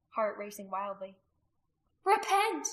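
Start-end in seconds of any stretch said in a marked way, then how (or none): 2.0-2.7 high, loud urgent cry. A narrator's reading. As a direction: call sinners back, desperately. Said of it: "Rizpa cried out".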